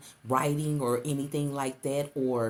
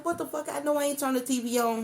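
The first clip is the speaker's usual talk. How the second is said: much higher, quick baffled outburst; 11 semitones higher, faster than usual. Retold or imitated. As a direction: rattle it off in high confusion.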